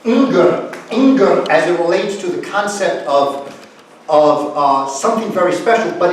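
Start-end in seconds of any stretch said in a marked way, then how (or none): none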